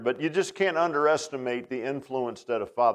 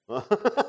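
short real laugh; 7 semitones higher than usual. Real, not acted. Laughs at own joke.

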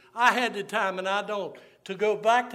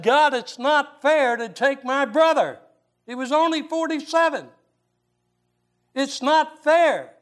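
higher and louder, railing at God; angry at God; 7 semitones higher, 5 dB louder than usual. Retold or imitated. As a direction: shout your grief up at the storm. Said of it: "And I am mad at God".

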